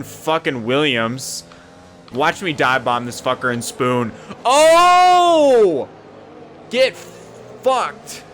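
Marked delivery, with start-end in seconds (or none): 4.4-8.2 loud, much higher yell then a higher jeer; triumphant shout. Real, not acted. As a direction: shout it as you bomb past. Dive bomb pass goes wrong.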